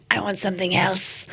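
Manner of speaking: gruff mock toddler demand; raspy little nephew. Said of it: "That's how he talks".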